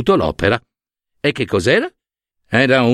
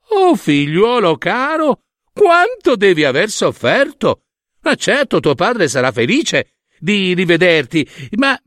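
much higher, put-on fussing mother voice; Hera, fake concern; 7 semitones higher than usual. Hera noticing her son's broken leg with much higher, falsely concerned voice. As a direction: coo over his leg with false worry.

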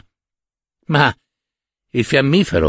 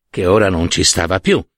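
plain reading voice

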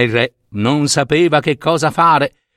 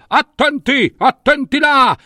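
high-pitched calling out of a warning; storybook alarm call; warning cry; 11 semitones higher than usual. Acted. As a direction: call out urgently to bystanders. Warning shouts behind the king.

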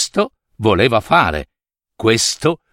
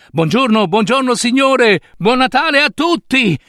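high, sing-out greetings to passers-by; joyful greeting; 10 semitones higher than usual. Acted. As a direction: hail everyone in the street, elated. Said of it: "Salutava chiunque incontrasse". (saluti esultanti, a voce acuta)

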